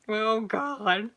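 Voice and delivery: ordinary delivery, nothing marked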